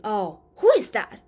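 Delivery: startled by a knock in the night. Shown as a demonstration. Teacher shows reaction to a 2 AM knock, startled. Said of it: "somebody knock your door at two A M".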